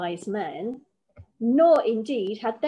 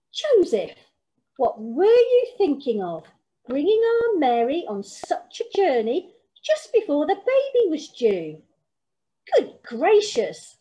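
flustered, scolding grandmother, louder and higher; a bossy granny taking charge in alarm; fussing granny; 6 semitones higher, 4 dB louder than usual. Acted. Granny scolding Joseph about the journey, flustered and loud.

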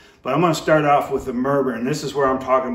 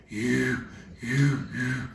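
goose murmur; 6 dB quieter, much slower than usual. Shown as a demonstration. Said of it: "bass in my throat".

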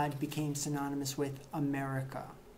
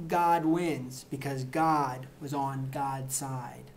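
slow, pointed deadpan; 3 semitones lower, slower than usual. Acted. Comic host deadpanning the war in heaven. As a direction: let each God land slowly.

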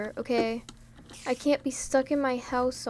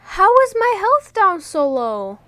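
higher and louder in sudden alarm; alarmed outburst; 8 semitones higher, 9 dB louder than usual. Real, not acted. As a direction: blurt it out, shocked at the damage. Alarmed at losing health in game.

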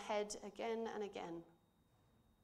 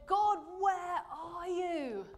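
slow, high, louder anguished plea; anguished cry; 9 semitones higher, 7 dB louder, much slower than usual. Acted. Jesus crying to God from the cross, slow anguished cry. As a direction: cry out to God in agony, drawn out. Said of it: "cried out and essentially said".

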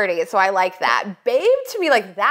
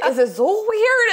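high doubtful question; 12 semitones higher than usual. Retold or imitated. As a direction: ask it high and unsure. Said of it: "I was like".

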